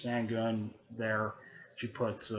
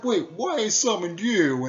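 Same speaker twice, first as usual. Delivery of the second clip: much higher and louder, a hammed-up puzzled voice; confused Jiraiya; 10 semitones higher, 8 dB louder than usual. Drugged Jiraiya puzzling at his summon, high and loud. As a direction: play it dopey and drugged. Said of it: "he's like".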